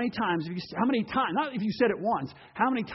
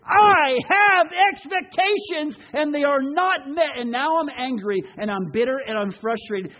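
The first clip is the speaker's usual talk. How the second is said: angry Jonah; 3 semitones higher, 5 dB louder than usual. Acted. Jonah complaining to God about unmet expectations, angrily. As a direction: voice a resentful prophet, loud at first. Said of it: "and he became angry".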